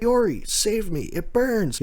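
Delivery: high, strained plea for help; pleading cry. Retold or imitated. Recapping a dying man begging Yori, high pleading voice.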